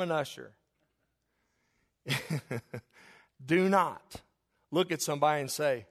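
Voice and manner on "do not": usual speaking voice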